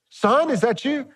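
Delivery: high, fake-sweet tone; sarcastic syrupy fatherly voice; mock sweetness. Preacher mocking Saul's fake affection for David. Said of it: "That just ticks me off".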